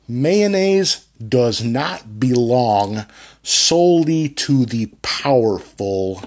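slow, carefully enunciated reading; diction drill. Shown as a demonstration. Coach's drill sentence, slow over-enunciated reading. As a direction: lean on every consonant, slowly. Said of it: "he had me reading sentences like".

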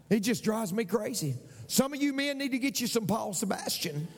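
usual speaking voice

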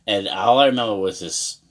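ordinary talk

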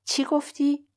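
a frightened agent's quick what; startled. Acted. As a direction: jump up in pitch, caught off guard. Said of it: "پنکوویچ ترسید".

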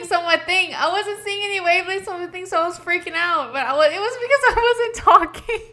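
real laughing confession. Real, not acted. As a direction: laugh it out, embarrassed at yourself. Laughing at her own panic.

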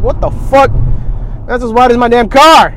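loud, high-pitched disbelief; loud incredulous retold yell; shocked outburst. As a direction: blurt it out, appalled at the size. Shocked reaction to huge mattress.

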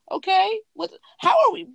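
incredulous what, how did we get here; baffled disbelief. Real, not acted. Retelling the club chat, she turns baffled and incredulous.

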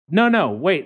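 usual speaking voice

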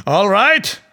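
mocking. Duryodhana taunting Draupadi, loud sneering. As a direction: toss it off with a jeering lilt. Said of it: "Duryodhana laughed at Draupadi and said".

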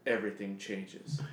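low, hushed, slow trailer tagline; movie trailer. Acted. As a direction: intone it like an ominous teaser, softly. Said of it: "and then it says".

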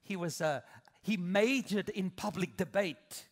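ordinary talk, nothing marked

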